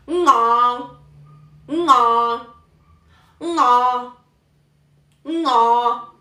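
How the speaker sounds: "ngo" loud and drawn out, starting on ng, in Cantonese tone; Cantonese tone two. Shown as a demonstration. Teacher demonstrating Cantonese tone two, loud drawn-out "ngo". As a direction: hit "ngo" hard with its nasal ng onset and moving tone. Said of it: "El número dos es bajar a un tono grave, luego subir".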